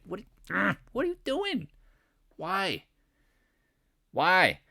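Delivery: high-pitched mock dismay; comically pained, high questioning; exasperated. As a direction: plead with him in squeaky disbelief. Retells exasperated warning to a trader.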